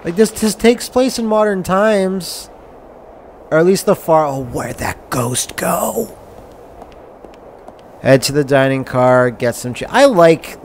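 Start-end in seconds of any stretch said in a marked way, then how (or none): 4.2-6.2 hushed whisper; slow, unvoiced whisper. Real, not acted. Whisper in game.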